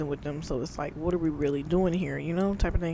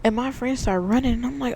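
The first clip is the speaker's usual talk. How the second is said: usual speaking voice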